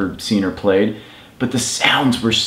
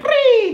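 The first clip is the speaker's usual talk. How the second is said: squawky bird call. Retold or imitated. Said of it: "someone was like".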